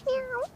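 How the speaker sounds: high mock cat meow. To the cat, a high playful meow.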